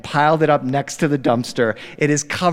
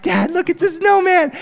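put-on toddler delight; 4 dB louder than usual. Son shows his snowman.

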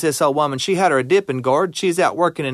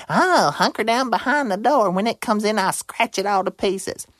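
bobcat voice; 6 semitones higher than usual. Acted.

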